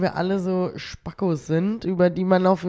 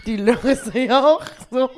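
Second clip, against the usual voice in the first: laughing; 6 semitones higher, 4 dB louder than usual. Real, not acted. Giggling at own joke.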